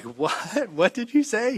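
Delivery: a laughing what, then jumping high in disbelief; startled dad, voice shooting up; surprised disbelief. Retold or imitated.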